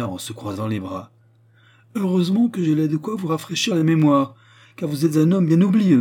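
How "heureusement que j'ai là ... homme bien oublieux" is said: high, loud, scornful queen; indignant rebuke. A narrator's reading. (reproche hautain, voix haute et forte)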